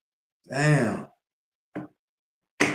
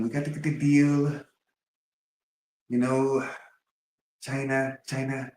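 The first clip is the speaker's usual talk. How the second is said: higher, mocking Trump voice; Trump impression; 5 semitones higher than usual. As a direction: ham up Trump's deal talk. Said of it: "why Donald Trump talk like that".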